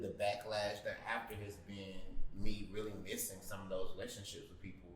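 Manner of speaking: ordinary talk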